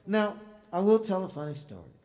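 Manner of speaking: booming out the start of a story; loud announcement. Real, not acted. Musician announcing a funny story to the crowd, loud and high.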